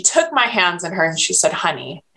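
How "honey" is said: soft Southern drawl on honey; a gentle Southern nurse easing in with honey; softer hint of a Southern accent. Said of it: "in her Southern accent".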